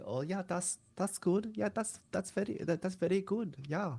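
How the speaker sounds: high-pitched mock German accent, v for w; lightly spoken, high German accent impression; German Hitler accent. Shown as a demonstration. Demonstrating the second kind of German accent, high mock German. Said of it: "replacing W's with V's".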